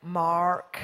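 imitated American "Mark", low and drawn out with a hard R; mock American pronunciation of "Mark"; American accent. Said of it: "You all Americans go".

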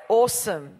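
Australian "Awesome", rounded first vowel, falling; Australian accent. Shown as a demonstration. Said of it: "Say it like an Australian".

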